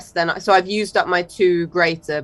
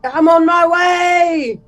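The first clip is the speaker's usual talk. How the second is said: shouted reply; 10 semitones higher, 4 dB louder, much slower than usual. Acted. Medic answers the call.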